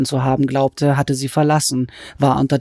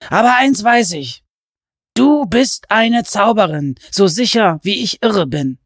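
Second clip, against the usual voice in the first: raised, insistent fervent man; Hatcher voice; 7 semitones higher than usual. Acted. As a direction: convince her she's magic.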